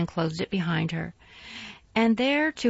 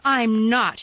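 louder sharp denial; snapped; 3 semitones higher, 8 dB louder than usual. A narrator's reading. Colin denying to Mary, loud and sharp. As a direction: snap it out. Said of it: "snapped Colin".

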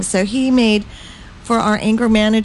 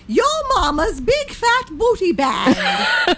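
laughing; 13 semitones higher, slower than usual. Pretend. Silly band name in character.

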